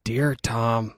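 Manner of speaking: lightly voiced mom reading aloud; Nancy. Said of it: "read it in her voice".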